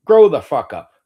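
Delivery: loud furious command; angry shout. Real, not acted. Streamer shouts down critics who call him crazy, furious. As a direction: bellow it in disgust.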